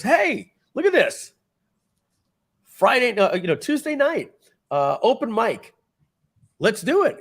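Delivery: high, loud pushy urging voice; acting out Charlie Sheen. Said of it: "Charlie Sheen looks and he goes".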